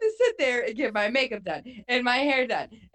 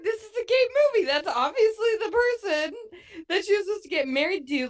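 high, fast, laughing gush; excited squeal; 9 semitones higher, faster than usual. Retold or imitated. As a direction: gush with teary-laughing glee. Retelling her excited reaction to film.

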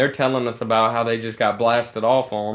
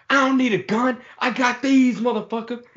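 much higher, hammed-up threat; imitated tough-guy threat; 11 semitones higher than usual. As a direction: play the coworker raising his fists, high and taunting. Coworker threatening with his fists.

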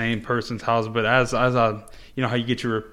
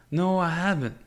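a hint of a higher old woman's voice; lightly voiced old lady; 7 semitones higher than usual. Paramedic mimicking the elderly patient's meek reply, lightly voiced. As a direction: answer meekly like the old lady. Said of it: "patient's reply".

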